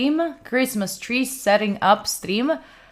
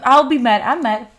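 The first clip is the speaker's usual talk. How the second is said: ordinary talk, nothing marked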